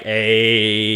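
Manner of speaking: long stretched low hey; drawn-out hey. Retold or imitated. Pat answering the phone with a long drawn-out hey. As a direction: lean back and draw the hey out. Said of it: "the real long drawn out one".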